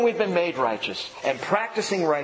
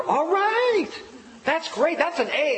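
high-pitched proud cheer, re-enacted; excited cheering; 12 semitones higher than usual. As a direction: act out a parent thrilled by a good grade. Acted father cheering daughter's grade.

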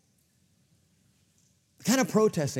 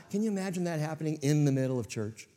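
normal talking voice

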